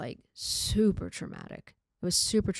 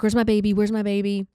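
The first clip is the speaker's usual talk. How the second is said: louder, mildly raised voice; quick, loud retold demand; retold yelling; 2 semitones higher, 7 dB louder, faster than usual. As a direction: imitate yelling in a panic, held back. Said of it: "I started yelling".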